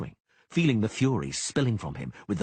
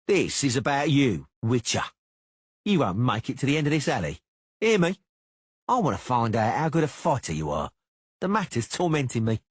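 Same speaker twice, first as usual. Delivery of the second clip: higher, louder sneering taunt; taunting threat; 5 semitones higher, 4 dB louder than usual. A narrator's reading. Cicada goading Geralt, higher and louder. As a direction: goad him into fighting.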